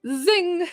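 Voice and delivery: high-pitched imitated zip of a dashing dog; high "zing" zooming noise. Pet psychic acting out the dog's zoomies, high zing. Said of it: "like zoomies".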